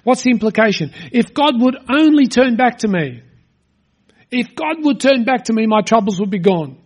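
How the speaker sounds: ordinary talk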